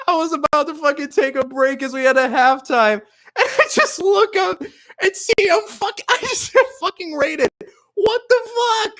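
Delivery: high, squeaky voice breaking into laughter; laughing disbelief. Real, not acted. Streamer recounting getting raided at halftime, laughing high. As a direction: tell it through giggles, pitch soaring. Retells the surprise raid, laughing.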